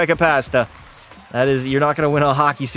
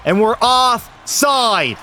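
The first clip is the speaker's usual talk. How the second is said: much higher, slow stretched yell; excited shout; 8 semitones higher, much slower than usual. Real, not acted. Goalie yelling as regulation ends, excited. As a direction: belt it out in relief. Game clock running out.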